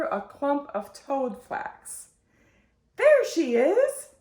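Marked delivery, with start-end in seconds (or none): 3.0-4.1 high, loud, slow scream. A narrator's reading. Mother spotting the runaway head, loud excited scream. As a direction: yell it out on spotting her. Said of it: "screamed Mother Lumps".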